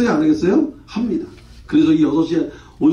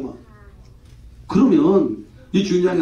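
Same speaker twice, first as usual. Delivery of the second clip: ordinary talk, nothing marked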